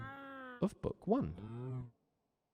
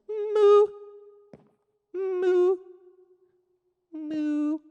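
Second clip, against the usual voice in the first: much higher, squeaked moo; tiny squeaky moo; 7 dB louder, much slower than usual. Shown as a demonstration. Teacher demonstrating a tiny cow's moo for chat. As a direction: moo like a kitten-sized cow.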